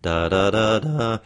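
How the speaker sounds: sung "da"s climbing from statement pitch; stepping up notes. Shown as a demonstration. Coach finding the question's starting pitch, climbing in notes. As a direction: count up the notes on "da". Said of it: "start about four notes up from there".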